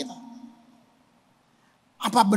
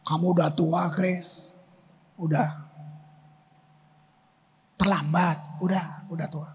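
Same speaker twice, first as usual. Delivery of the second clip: lower, hushed mocking voice of the tempter; devil's taunt; 6 semitones lower, 6 dB quieter than usual. The devil taunting the old pastor, low hushed sneer. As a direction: drip doubt into his ear, low and soft. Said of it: "Si kunyuk memang begitu".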